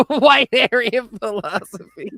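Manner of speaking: laughing. Real, not acted.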